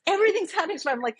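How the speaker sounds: ordinary talk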